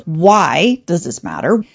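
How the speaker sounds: normal talking voice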